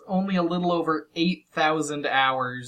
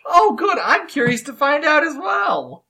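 mock excitement; 10 semitones higher, 3 dB louder than usual. Mock eager about wife's question.

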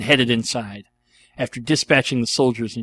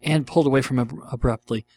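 plain reading voice

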